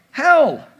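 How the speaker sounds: sudden loud, high shout of the word; emphatic shout. Real, not acted. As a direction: hit the word like a hammer. Punchline answer to congregation.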